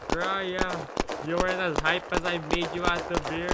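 loud frustrated yelling; real rage at the game; angry shouting. Real, not acted.

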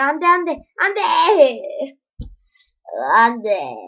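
loud, drawn-out panicked cries; desperate no. Real, not acted. Gamer watching his ninja die, loud panicked pleading. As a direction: beg the game not to let you die.

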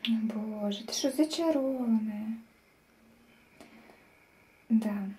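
ordinary delivery

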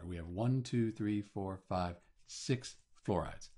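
normal talking voice

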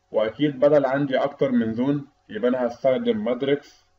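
usual speaking voice

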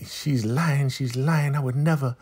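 high mock Marva voice. Host imitating Marva protesting her innocence, high and whiny. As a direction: pitch way up, a whiny denying Marva.

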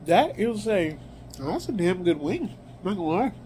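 speaking between bites; chewing while talking. Real, not acted. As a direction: mouth partly full of food. Seen 0: chewing motions between words, then lifts wing to mouth.

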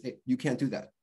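ordinary delivery

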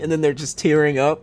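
normal talking voice

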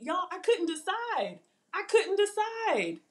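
high, slow drawn-out whine; playful dilemma. Real, not acted. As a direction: stretch it out like it's agony.

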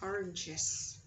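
"oranges" closing on iz; careful "oranges" with an -iz final syllable; ES read as IZ. Shown as a demonstration. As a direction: sound out the iz ending of "oranges". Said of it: "Ok, I Z".

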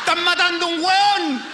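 high, slow, drawn-out cry of alarm; yelling across a party that someone is being killed; mock alarm. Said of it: "Yo pensaba".

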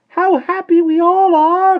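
very high, loud, drawn-out cry; ecstatic cry. A narrator's reading. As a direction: cry out at the top of the voice, stretched. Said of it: "cried Miss Tartlett".

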